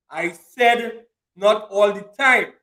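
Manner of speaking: slow, deliberate, with pauses between phrases; slow emphatic restatement. Real, not acted. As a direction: spell the point out slowly. Repeats own point for emphasis.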